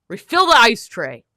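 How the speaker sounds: loud, barked order; furious shouted demand; angry command. Real, not acted. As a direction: snap the order at the whole household. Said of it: "If I seem angry, it is, I am".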